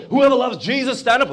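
loud, high-pitched altar call; preacher's appeal. Acted. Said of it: "when the preacher makes an appeal".

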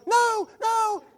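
high wailed refusals; fake screaming. Friend on the couch faking a beating, wailing. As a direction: cry out in pretend agony. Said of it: "smiling and screaming".

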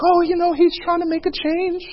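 soft and high, making excuses for him; quoted weeping girl. Retold or imitated.

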